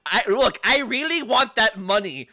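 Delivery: high, loud greedy witch voice; acted Baba voice. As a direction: play a greedy old witch begging for cash. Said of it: "She just goes".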